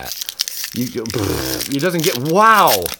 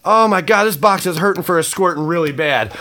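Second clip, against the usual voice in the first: normal talking voice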